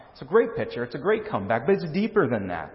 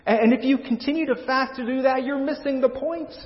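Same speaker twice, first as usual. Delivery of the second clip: higher, insistent preacher's voice for Jesus, stretched on key phrases; Jesus answering Israel; 7 semitones higher than usual. Acted. Preacher voicing Jesus' answer on fasting to Israel, higher and insistent. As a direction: plead the case, pitch climbing higher as it builds. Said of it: "So here's Jesus' longer answer".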